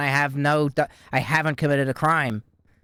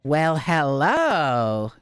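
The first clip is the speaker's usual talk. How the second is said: slow, drawn-out hello; drawn-out greeting; 2 semitones lower, much slower than usual. Acted. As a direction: stretch out the hello smugly. Greeting the sergeant slowly.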